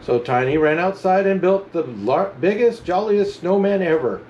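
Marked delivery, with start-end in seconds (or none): none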